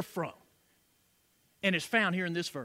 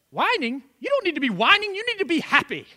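mock scolding; 8 semitones higher, 5 dB louder than usual. [name] scolds the supposed whiner.

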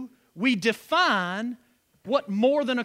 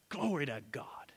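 soft whisper of praise; 6 semitones lower, 11 dB quieter than usual. Real, not acted. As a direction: breathe it out as a whisper. Whispered praise after the verse.

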